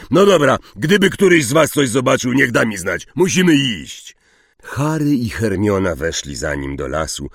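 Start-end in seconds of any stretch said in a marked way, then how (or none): 0.1-4.2 loud, hurried sign-off; higher, exasperated instructions; brusque. A narrator's reading. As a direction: wrap it up impatiently and leave. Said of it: "burknął niezbyt uprzejmie".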